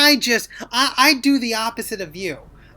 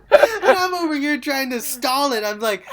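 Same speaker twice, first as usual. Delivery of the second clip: normal talking voice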